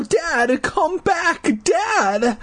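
high desperate calling. A narrator's reading. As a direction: call after him in panic. Boy calling after father.

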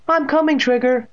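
high, loud call to the dog; boy calling his dog. A narrator's reading. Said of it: "Evan called".

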